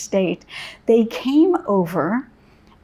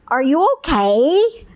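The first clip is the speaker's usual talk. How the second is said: put-on little girl asking; 5 semitones higher, 5 dB louder than usual.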